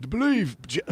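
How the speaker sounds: high-pitched attempt at a British accent. Shown as a demonstration. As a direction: have a go at an English football manager's British accent. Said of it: "a deep voice British accent".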